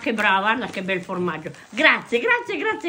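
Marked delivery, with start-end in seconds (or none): 1.7-2.9 excited thanks. Real, not acted. Grandma thrilled by gifts.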